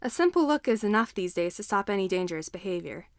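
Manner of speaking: usual speaking voice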